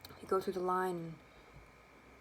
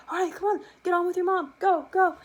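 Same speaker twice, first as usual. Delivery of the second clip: loud, high, urgent hustle; dad sending his daughter off with mom; hurrying dad; 11 semitones higher, 9 dB louder than usual. Retold or imitated. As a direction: rush her back into line. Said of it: "my dad's like".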